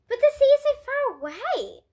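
a dad staggered his son claims the sea is near; astonished cry. A narrator's reading. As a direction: cry out that it's impossible. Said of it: "cried the dad".

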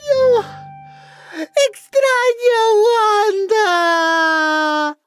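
long, drawn-out wail; tearful outburst. Acted. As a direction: wail slowly for the missing wife. Husband breaks down missing his wife.

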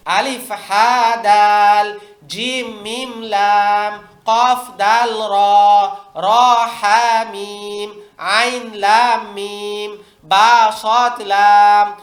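put-on very slow, drawn-out letter names for children; slow drill reading. Shown as a demonstration. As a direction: spell each pair out slowly for the class to copy. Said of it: "উস্তাদ এক line এক line পড়াই দিবেন". (ধীরে টেনে টেনে হরফ পড়া)

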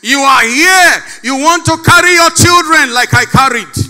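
loud, high, urgent declaration; fervent preaching. Acted. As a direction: press it on the crowd. Preaching at full force.

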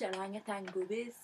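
put-on amateur go at a Scottish accent; playful mock-Scottish accent; attempted Scottish accent. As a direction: imitate a Scot, not very convincingly. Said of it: "say it in a Scottish accent".

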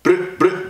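rapid rolled gun noise; imitated gunfire. Reviewer acting out the automatic line, imitated gunfire. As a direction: trill it like automatic fire.

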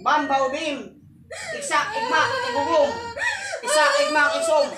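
chant, then blast the last word; incantation. Acted. Healer chanting a spell, ending in a shout.

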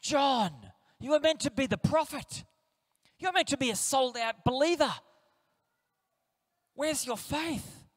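mock-scold a doubting friend; stern scolding Jesus. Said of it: "He doesn't reply by saying".

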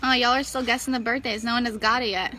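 ordinary delivery

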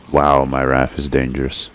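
ordinary delivery

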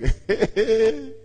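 a short mocking laugh that rises; scornful laugh. Stubborn man in pit scoffing at helper, mocking laugh. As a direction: laugh off the offer as a trick. Man mocks his rescuer.

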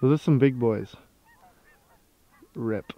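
ordinary talk

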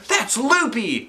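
high, slow put-on voice; teacher character voice. A narrator's reading. Teacher scoffing at the plan, high slow voice.

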